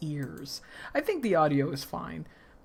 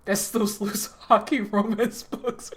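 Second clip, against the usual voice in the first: laughing; 4 semitones higher, 5 dB louder than usual. Real, not acted. Laughs at own joke.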